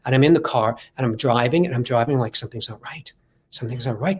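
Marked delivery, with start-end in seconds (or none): 2.3-4.2 low, soft uneasy muttering to himself. Retold or imitated. Driving to the audition, he mutters uneasily to himself. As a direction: fret under your breath that something is off.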